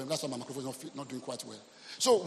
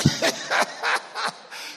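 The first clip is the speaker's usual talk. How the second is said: real laughter; 4 dB louder than usual. Real, not acted. Pastor laughing at his own outcry, helpless burst. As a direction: burst of laughing after a shout.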